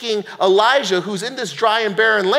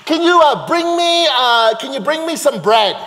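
higher, louder calling out; audacious request; 8 semitones higher, 4 dB louder than usual. Acted. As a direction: call after her with cheeky nerve. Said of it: "Now imagine the audacity here".

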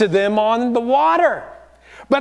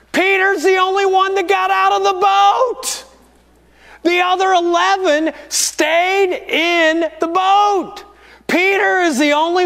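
emphatic preaching; 8 semitones higher than usual. Real, not acted.

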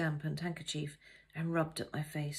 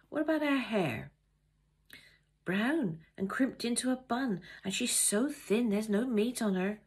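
kitchen gossip; 5 semitones higher, 3 dB louder than usual. Pretend. Kitchen gossip between servants.

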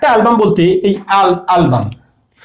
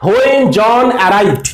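slow example sentence; much slower than usual. Shown as a demonstration. A teacher reads the example slowly to the class. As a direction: stretch out when John arrived very slowly. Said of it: "একটা জিনিস লক্ষ্য করো".

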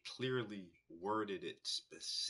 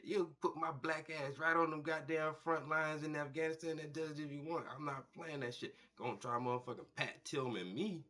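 normal talking voice

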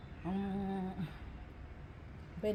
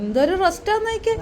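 teasing call; 6 semitones higher, 11 dB louder than usual. Real, not acted. Teasing husband resting, calling loudly.

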